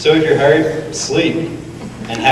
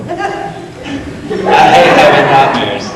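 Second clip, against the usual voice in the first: emphatic complaint; 2 semitones higher, 5 dB louder than usual. Acted. Complaining about nightmares.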